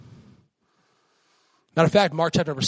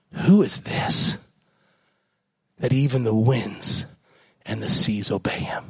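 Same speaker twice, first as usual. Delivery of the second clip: awed whisper; 6 semitones lower than usual. Acted. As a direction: breathe the question in wonder.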